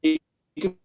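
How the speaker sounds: choppy broken-up call audio; call dropping out. Real, not acted. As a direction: voice cutting in and out. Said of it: "You're breaking up".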